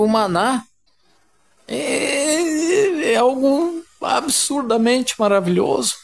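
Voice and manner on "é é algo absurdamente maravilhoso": voice breaking. Real, not acted. Moved preacher, voice breaks.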